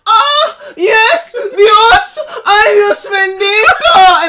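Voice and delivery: high, loud, drawn-out moaning cry; hammed-up ecstatic church moan; mock moaning. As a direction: wail it like a woman in rapture. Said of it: "she was literally moaning".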